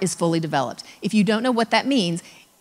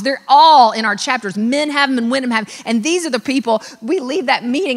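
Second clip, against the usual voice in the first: normal talking voice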